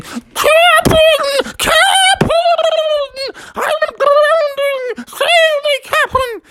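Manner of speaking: very high, burbling cries underwater; drowning panic. A narrator's reading. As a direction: squeal for rescue as if drowning. Smee drowning under water.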